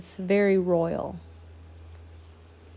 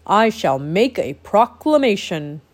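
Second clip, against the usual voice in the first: louder, grand royal voice; heroic regal voice; 5 dB louder than usual. Acted. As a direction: proclaim it like a noble king. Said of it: "he said in a heroic, regal voice".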